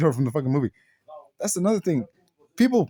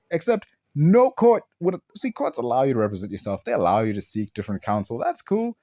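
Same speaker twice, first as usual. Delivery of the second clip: normal talking voice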